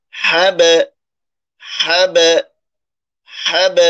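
হ্যাব with the b bounced out like an echo; qalqala demo. Shown as a demonstration. Teacher shows students the echoing b. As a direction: pop the final b of হ্যাব. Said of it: "এই যে একটু প্রতিধ্বনির মতো হচ্ছে".

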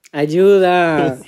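loud playful yell; shout for help. Real, not acted.

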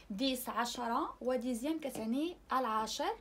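normal talking voice

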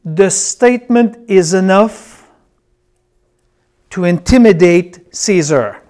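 deliberate teaching, long pauses between phrases; going slow. Real, not acted. Preacher explaining what's going on here for note-takers, going slow. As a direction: let each phrase land before the next. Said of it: "I will go slow now".

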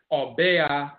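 "Obea" as aw-BAY-ah; Akwamu pronunciation. Shown as a demonstration. Lecturer giving the Akwamu word, "Obea" as aw-BAY-ah. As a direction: slip an ay into "Obea" before ah. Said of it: "in the Akwamu dialect is".

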